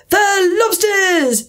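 high, long-drawn shout. A narrator's reading. Gryphon breaks into the dance instructions, shouting. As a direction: holler it high, stretching the words. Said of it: "shouted the Gryphon".

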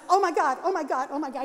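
loud flustered oh my God; panicked exclaim, rising; teacher's panicked gasp. Retold or imitated. Organ teacher, shocked at her pants: flustered panic.

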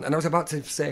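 ordinary talk

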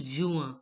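juin as "joo-ah"; French pronunciation. Shown as a demonstration. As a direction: end juin on an "a" sound, no firm n. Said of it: "I N is pronounced as A in French".

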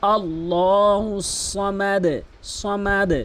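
slow, drawn-out recitation of আল্লাহু Samad; Quran recitation. Shown as a demonstration. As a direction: recite like in prayer, slowly. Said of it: "তাহলে কি হলো".

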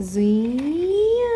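long drawn-out rising zoom; car zoom noise. Shown as a demonstration. Mother shows crying baby how car goes, drawn-out zoom. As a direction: stretch the zoom out slowly, sliding upward. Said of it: "ಹೆಂಗೋಗುತ್ತೆ ಗೊತ್ತಾ".

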